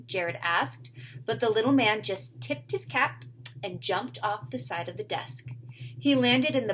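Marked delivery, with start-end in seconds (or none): none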